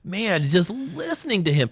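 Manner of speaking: high, awed exclamation; moved and awed. Real, not acted. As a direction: voice lifting with goosebumps. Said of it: "I just got goosebumps again".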